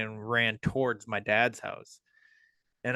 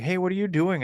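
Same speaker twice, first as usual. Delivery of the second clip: mild retold call, raised pitch; 8 semitones higher than usual. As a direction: lightly imitate hailing a stranger. Calling to stranger in the woods.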